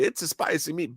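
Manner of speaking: put-on hint at an old-country Italian accent; Italian immigrant grandfather. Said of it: "my grandfather's voice".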